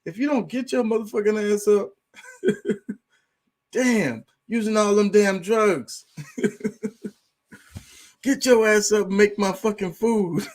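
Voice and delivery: a bossy cat yelling at its owner to get up, laughing through it; demanding cat voice. Retold or imitated. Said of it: "The cat gonna be like".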